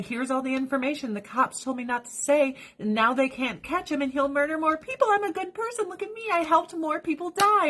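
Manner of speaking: high, smug mocking voice; mock her as a self-satisfied politician. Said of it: "she went on the news and said".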